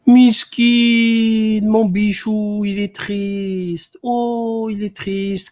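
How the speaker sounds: put-on high sing-song baby talk; syrupy mock sympathy, high and slow; mock pity. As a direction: coo at him like a sad pet, sneering. Mock pity for a sad man.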